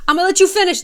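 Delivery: hammed-up stage crasher cutting in. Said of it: "It's like".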